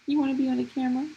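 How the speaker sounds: put-on high squeaky baby talk; squealing baby talk aunt. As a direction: coo to the baby. Cooing to baby.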